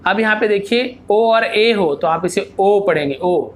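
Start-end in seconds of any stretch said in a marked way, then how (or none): none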